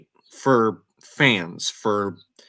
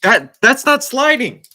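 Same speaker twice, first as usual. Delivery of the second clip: louder and higher, worked up; heated; 9 semitones higher, 7 dB louder than usual. Real, not acted. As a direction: let the outrage push the pitch up. Heated rant about the stage rush.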